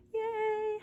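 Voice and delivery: small high-pitched celebration; little cheer. Real, not acted. Celebrating a finished arm.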